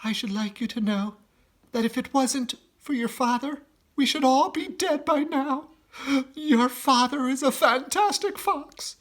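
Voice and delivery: high, proud praise; mother praising father to children; admiring mother voice; proud mother fox. Acted. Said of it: "Missus Fox said to her children".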